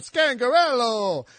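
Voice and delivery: Scangarello drawn out with playful relish; mock-grand announcement of Scangarello; hammed-up name. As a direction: ham up the name, stretching it out. Repeats the hammed-up name from before.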